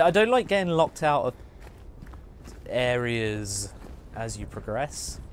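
ordinary delivery

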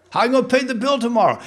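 mock worry. Voicing a worried believer.

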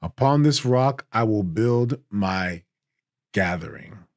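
slow, deliberate reading, landing on gathering; slow reread with gathering. Shown as a demonstration. To the congregation, reading the verse slowly with gathering. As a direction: swap in gathering and let it sink in. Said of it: "is used for gathering".

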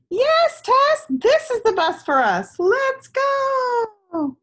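high, loud, thrilled announcement; excited yes. Acted. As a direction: cheer it out, the bus is finally here. Gus announces the bus arrived.

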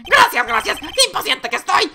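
very high eager clown voice; hopeful clown. Acted. Clown hopes court will listen, high-pitched eagerness. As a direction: ask in delighted, high-pitched disbelief.

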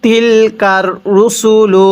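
রুসুলু said short, no drawn-out vowel, slowly; correct recitation. Shown as a demonstration. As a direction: read রুসুলু without stretching it. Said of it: "এই রুসুলু এখানে টান দিব না". (রুসুলু টান ছাড়া ধীরে পড়া)

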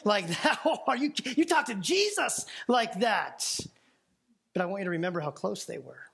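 ordinary talk, nothing marked

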